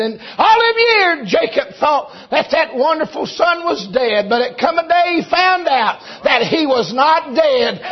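rising into a loud, high, tearful cry; preaching at full force. Acted. Preacher telling of Jacob's son, crying shout.

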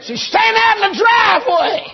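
high, loud shouted preaching; preaching at full force. Acted. Preacher shouting grandmother's story to congregation, full force. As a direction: cry it out to the church.

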